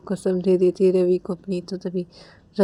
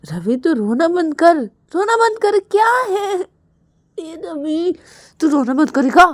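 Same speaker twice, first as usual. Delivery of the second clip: scolding; 7 semitones higher than usual. Retold or imitated. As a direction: tell him off to stop crying. Scolding Ravi to stop crying. (डाँटते हुए, ऊँची तेज़ आवाज़ में)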